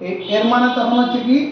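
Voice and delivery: ordinary talk, nothing marked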